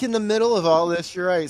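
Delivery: ordinary talk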